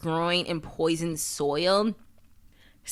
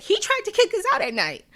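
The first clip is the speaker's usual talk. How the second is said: much higher, put-on panicky bus driver voice; mock bus driver; 13 semitones higher than usual. Bus driver complaining to the colony, mock high voice. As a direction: squeak it out like a frantic bus driver. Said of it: "the bus driver who was like".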